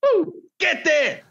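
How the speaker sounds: high, slow, hammed-up "ooh, get there"; mock cheer. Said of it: "I didn't say".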